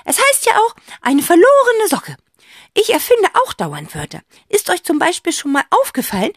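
high, loud chatty aunt; eccentric aunt. A narrator's reading. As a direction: ramble happily about invented words. Aunt figure voice.

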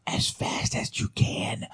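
hushed, slow urging to flee; a slow, breathy warning said under the breath; urgent whisper. Acted. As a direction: whisper it slowly, like a secret warning.

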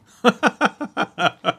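loud, high peals of laughter; long real laugh; laughing hard. Real, not acted. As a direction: burst out laughing at the punchline. Laughs hard at end of anecdote.

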